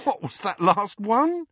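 high, amused question; giggling. A narrator's reading. Hermione asking Ron about a silly title, giggling. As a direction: ask with a giggle in the voice.

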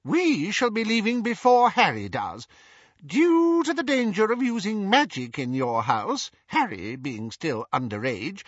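high, eager little wizard voice; a chirpy, over-polite wizard explaining the plan; Daedalus's high, excitable voice; fussy wizard Daedalus. A narrator's reading.

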